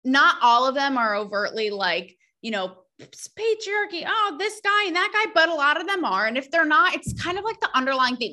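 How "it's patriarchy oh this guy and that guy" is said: high mock whine, much higher than her own voice; mocking whiny complainer. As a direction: mimic the complainers in a high, sneering voice. Mocking women who complain.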